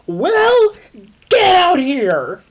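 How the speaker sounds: high, loud furious shout; angry yell. Acted. Christina yells for Will to come out. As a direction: bellow at him to come out.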